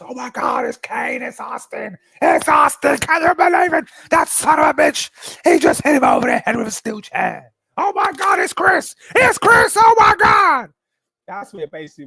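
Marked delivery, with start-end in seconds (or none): 0.0-10.7 high, loud, frantic wrestling commentator screaming; hammed-up ringside call of a chair shot; Jim Ross commentary. Imitating Jim Ross screaming the call as Austin hits the chair shot. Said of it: "like, Jim Ross".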